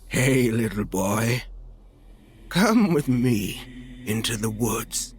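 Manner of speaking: slow, strange bleating goat man. A narrator's reading. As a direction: lure the boy in like a goat. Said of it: "in a strange voice that sounded like a goat".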